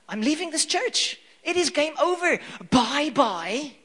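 mock outrage, storming off; hurt disciple ranting before walking out; offended Peter. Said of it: "I'm like".

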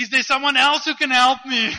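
very loud, high shout for someone else; comic desperate yell. Acted. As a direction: bellow out for any other rescuer. Hanging man calls past God for help.

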